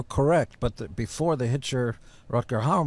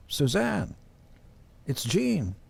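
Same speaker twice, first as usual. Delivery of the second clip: higher imitation of Gene's growl; [name] impression; 6 semitones higher, slower than usual. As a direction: play a caller imitating Gene. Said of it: "all of a sudden you hear".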